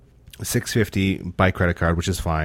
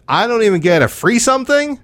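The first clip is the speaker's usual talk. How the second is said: indignant; 12 semitones higher, 4 dB louder than usual. Retold or imitated. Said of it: "I'm like".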